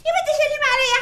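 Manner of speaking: high cackling granny voice, teasing; laughing old woman. Acted. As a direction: laugh through the words like a playful grandma. (ضحك عجوز)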